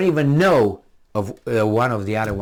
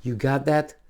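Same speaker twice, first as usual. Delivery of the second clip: ordinary delivery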